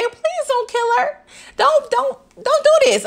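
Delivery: high-pitched playful begging; mock pleading. Begging Saxe to spare Lauren, high mock pleading.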